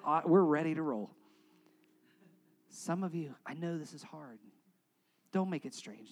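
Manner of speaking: normal talking voice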